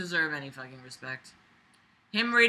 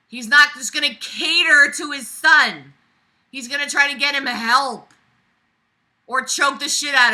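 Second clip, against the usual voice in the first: higher, louder scolding; heated lecture; 6 semitones higher, 8 dB louder than usual. Real, not acted. Reader lecturing the writer's father, heated. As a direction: lay it out forcefully.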